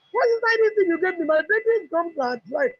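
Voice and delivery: a thrilled mother asking what magic this was; excited. Retold or imitated. Mother phoning the seller about her baby's healed gums, high and amazed.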